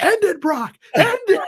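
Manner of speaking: high-pitched screaming-fan impression; Greg Miller. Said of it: "with his very distinctive Greg Miller voice".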